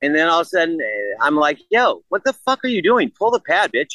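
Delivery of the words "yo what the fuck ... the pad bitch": high-pitched, incredulous egging on; retold goading. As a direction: re-enact hyping up a friend with mock outrage.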